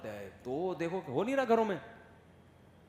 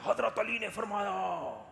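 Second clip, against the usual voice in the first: slow, booming pulpit voice; loud preacher; 5 semitones higher, slower than usual. Retold or imitated. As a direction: boom it out like the imam. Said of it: "وہ کہہ رہے تھے".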